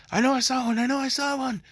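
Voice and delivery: high, eager, searching; excited. Retold or imitated. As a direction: blurt it out, eager. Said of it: "he's just like".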